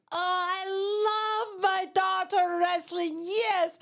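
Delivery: imitating her dad's thick Iranian accent, pitched high and slow; a proud Iranian father's heavy accent, drawn out; playful high, slow take on a thick Iranian accent. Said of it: "my dad in his thick Iranian accent goes".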